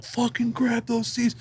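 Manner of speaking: high, slow, hushed urge; hushed urging friend. Retold or imitated. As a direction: push your buddy under your breath. Friends urging grab seats.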